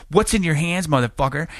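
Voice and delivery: high, loud retold demand; challenging the man as a cocky teen; high mock yell; angry demand. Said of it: "I'm like".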